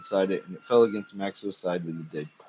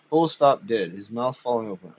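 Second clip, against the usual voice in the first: ordinary delivery, nothing marked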